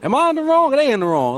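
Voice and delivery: incredulous retort. Retold or imitated. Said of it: "you look at them like".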